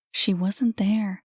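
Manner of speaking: usual speaking voice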